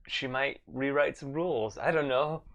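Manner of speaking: ordinary delivery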